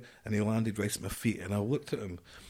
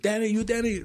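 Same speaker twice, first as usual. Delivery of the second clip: much higher, louder voice calling a name; a hint of the American sergeant; 14 semitones higher, 5 dB louder than usual. Sergeant Nick greeting Denny, lightly voiced. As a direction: call out like a sergeant meeting his new man. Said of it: "First thing he said".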